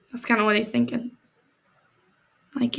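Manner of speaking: usual speaking voice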